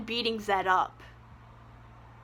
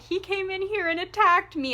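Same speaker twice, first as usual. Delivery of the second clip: higher, whining complaint; mock whiny tattle; 7 semitones higher than usual. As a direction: tattle like a crybaby. Voicing Zed tattling.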